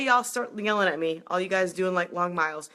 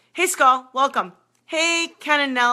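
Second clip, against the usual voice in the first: ordinary delivery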